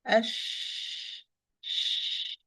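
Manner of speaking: unvoiced sh with no vibration; whispered sh demo. Shown as a demonstration. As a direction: breathe out a soft sh. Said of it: "If there is a vibration".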